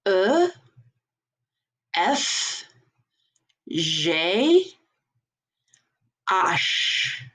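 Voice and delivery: "E, F, G, H" as "uh, eff, zhay, ahsh"; French letters, spaced one by one; French letter names. Shown as a demonstration. Teacher modelling next four, French "uh, eff, zhay, ahsh". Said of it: "our next four".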